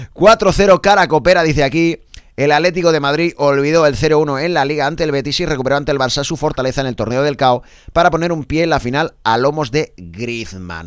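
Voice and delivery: loud announcer opening, easing off at the end; sports headline read. Acted. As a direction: launch the match headline like a radio presenter.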